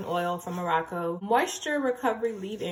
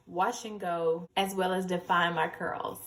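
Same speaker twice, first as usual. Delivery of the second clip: normal talking voice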